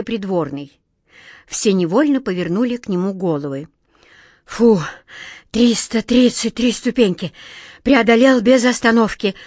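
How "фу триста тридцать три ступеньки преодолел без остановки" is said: mildly acted breathlessness, raised and loud; out of breath. A narrator's reading. Courtier just up the stairs, acting winded. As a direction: gasp it out after a long climb. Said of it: "задыхаясь, проговорил он".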